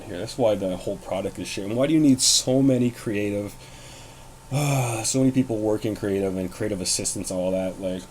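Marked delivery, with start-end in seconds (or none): 3.6-5.0 long, heavy exhale of frustration; exasperated sigh. Real, not acted. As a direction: let out a fed-up sigh mid-rant. Exasperated at too many creatives.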